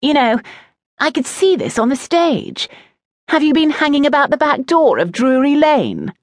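high-pitched, theatrical teasing; mock your friend with false admiration; jeering boy, pitched well up; taunting schoolboy. A narrator's reading. Oakenrot mocks Vander's supposed admirer.

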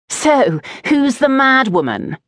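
high-pitched, nosy needling; lightly voiced taunting boy. A narrator's reading. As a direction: probe teasingly for the name. Oakenrot taunts, demanding poet's name.

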